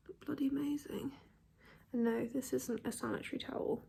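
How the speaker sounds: whispering in pain, hardly voiced; hushed, sore whisper for a migraine; quiet whisper. Real, not acted. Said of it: "I have a migraine".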